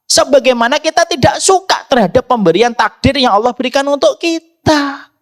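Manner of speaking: high-pitched, driving sermon voice; impassioned preaching. Real, not acted. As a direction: preach it insistently, pitched well up. (berkhotbah dengan nada tinggi dan berapi-api)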